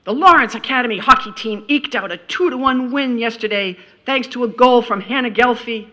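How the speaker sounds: a touch of a stiff sportswriter; lightly hammed newspaper voice; mock pro journalist. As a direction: read it like a serious news report. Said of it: "here's how I would do it".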